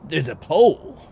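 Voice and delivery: ordinary talk, nothing marked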